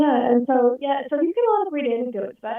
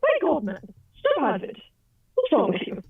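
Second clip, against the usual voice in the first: lightly voiced exasperated Englishman, starting high; mock protest, falling from high to usual pitch; Stephen Fry; 5 semitones higher, 3 dB quieter than usual. Friend freaked out by actor's accent, mildly exasperated imitation. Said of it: "freak out Stephen Fry because he'd be like".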